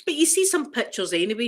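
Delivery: normal talking voice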